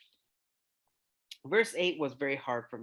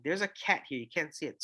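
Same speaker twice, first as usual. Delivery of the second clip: normal talking voice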